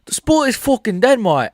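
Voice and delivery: a failed, high British accent attempt; bad British accent. Shown as a demonstration. Streamer attempting a British accent for chat, badly. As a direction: try a British accent and miss. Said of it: "I can't do the British accent".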